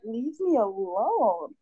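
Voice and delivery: very slow, drawn-out, each word weighed, leave me alone. Retold or imitated. Retelling how she answered a pestering man, slow and drawn out. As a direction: stretch it out slowly like a tired warning. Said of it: "and I was like".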